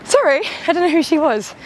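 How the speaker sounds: bright, high, sudden burst of chat; bubbly and chatty. Retold or imitated. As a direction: come alive, lively and chatty. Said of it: "suddenly I'm like".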